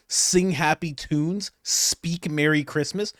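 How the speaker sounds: drawn out, incredulous rise; slow baffled repeat of the phrase; slow mocking echo. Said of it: "such a foreign way of saying it".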